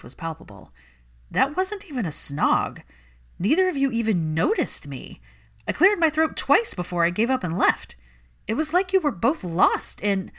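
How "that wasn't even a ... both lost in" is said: higher, louder teasing friend voice; Ginny teasing. Acted. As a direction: push her, half laughing in disbelief. Ginny protesting to Hermione.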